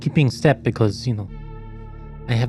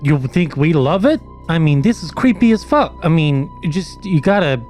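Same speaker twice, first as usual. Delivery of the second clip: higher, agitated in-character protest; exasperated retort; 4 semitones higher than usual. Acted. An adventurer answering the android about creepy eyes, exasperated high protest. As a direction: throw it back with rising agitation.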